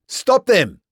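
high, loud bellow. A narrator's reading. Boer rousing the crowd against the British, bellowing. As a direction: roar at the crowd to stop them. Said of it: "the Boer bellowed".

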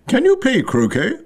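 high, shrill called-out question; mildly shouted queen's question. A narrator's reading. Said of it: "shouted the Queen".

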